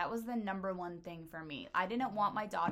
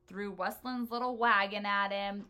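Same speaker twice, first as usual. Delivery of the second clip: ordinary talk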